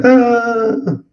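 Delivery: high drawn-out gasping "ah"; inspiratory whoop. Shown as a demonstration. Teacher showing the whooping-cough sound, a high inhaled whoop. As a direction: suck air in with a long high whoop. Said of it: "que a gente chama guincho".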